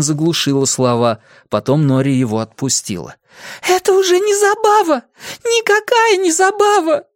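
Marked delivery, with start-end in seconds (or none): 3.7-7.0 put-on very high, anguished girl's cry; крик сквозь слёзы. A narrator's reading. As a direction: wail it through tears at the top of your pitch. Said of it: "сквозь слёзы выкрикнула она". (отчаянный высокий крик сквозь слёзы)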